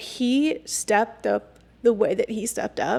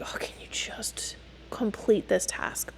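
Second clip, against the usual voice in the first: whispered, much softer impatient ask; whispered nag; 10 dB quieter than usual. Retold or imitated. Nagging him to finish the dishwasher, in a whisper. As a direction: hiss the request under your breath.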